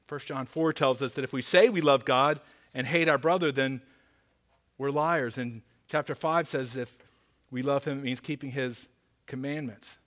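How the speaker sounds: usual speaking voice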